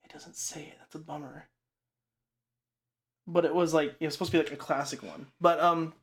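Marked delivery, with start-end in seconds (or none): 0.0-1.5 whispered aside to herself; whispered letdown over her notes. Real, not acted. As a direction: drop to a breath, let down. Checking notes under breath.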